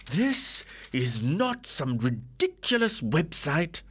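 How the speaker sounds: high, slowed, indignant insistence; indignant wife. Acted.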